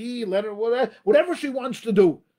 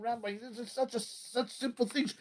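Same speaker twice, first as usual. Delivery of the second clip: mock dismissive listener; 12 dB quieter than usual. Rabbi imitating a skeptical listener, muttered and soft. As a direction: wave it off as too simple, under your breath. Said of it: "Now you think".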